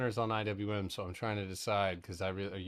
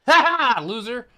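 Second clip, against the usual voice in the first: loud high cackle then a taunt; triumphant shrieking laugh; gloating laugh; 19 dB louder than usual. Real, not acted. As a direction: laugh in triumph over the loser. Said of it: "I get a little bit excited sometimes".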